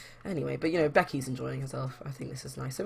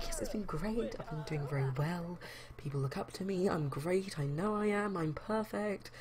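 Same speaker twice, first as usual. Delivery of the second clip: a higher, self-satisfied boast; smug bragging; 4 semitones higher, 3 dB quieter than usual. Acted. Jamie boasts to a friend about work, smugly. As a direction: preen like he's the best.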